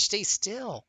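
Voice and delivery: high, sing-song plea to the cat; high baby talk to a squirming cat; coaxing pet voice. Acted. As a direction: gently coax a wriggling pet.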